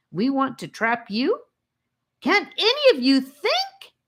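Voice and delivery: put-on high, loud angry boy; furious, exasperated tirade; demanding angrily. A narrator's reading. Charlie demands angrily that the captives think. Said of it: "he demanded angrily".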